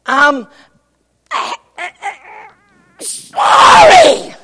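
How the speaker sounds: sudden loud breaking plea; anguished apology. Acted. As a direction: wail the word sorry, stretched high. Wife's confession acted out.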